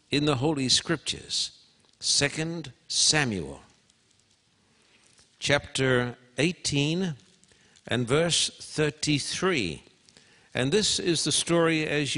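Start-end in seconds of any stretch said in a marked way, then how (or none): 2.0-9.8 slow, each word apart; slow, spaced reference. Shown as a demonstration. Preacher repeating the reference to the congregation, slow and spaced. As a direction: dictate it slowly so they can find it. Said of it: "I want you to turn over here".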